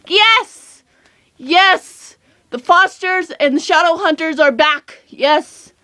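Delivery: high-pitched mock scream of joy; retold excited scream. Fan reliving news that the shows are back, excited. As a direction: re-enact an excited cheer, held short of screaming. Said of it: "I screamed, you guys".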